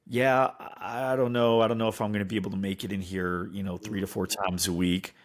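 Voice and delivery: low, hurried, doubtful mumble; hesitant prospect. Acted. Prospect unsure about making it in, hesitant doubt. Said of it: "when somebody's like".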